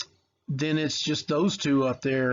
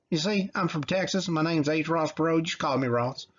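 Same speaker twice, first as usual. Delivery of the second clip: put-on a light Texas accent, higher, introducing himself; Ross Perot impression; 4 semitones higher than usual. Teacher imitating Ross Perot for students, light Texas accent. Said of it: "that's how he talked".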